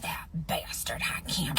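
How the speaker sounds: whispered, slow, angry grumble; muttering under breath. Retold or imitated. Mad wife in the driveway, muttering under her breath. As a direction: mumble it almost without voice. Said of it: "She mumbling something under her breath".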